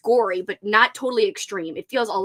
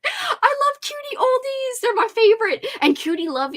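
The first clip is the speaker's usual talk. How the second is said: gushing squeal. Real, not acted. Host gushing to viewers about old ladies who read horror. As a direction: squeal it out with pure delight.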